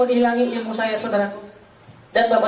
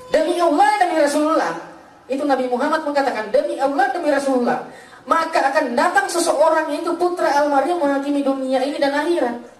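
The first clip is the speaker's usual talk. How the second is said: high, declaiming preacher's voice; emphatic preaching; 5 semitones higher than usual. Acted. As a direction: proclaim it like a solemn oath, pitched high.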